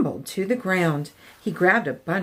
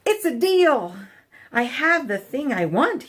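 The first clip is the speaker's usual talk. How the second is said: high, louder happy yell; 6 semitones higher, 3 dB louder than usual. A narrator's reading. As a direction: shout for joy at the deal. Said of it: "The Minosaur yelled".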